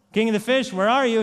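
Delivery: loud call across the water. Acted. Fisherman calls the king of the fish over the ocean, loud call. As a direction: shout out to sea for the king.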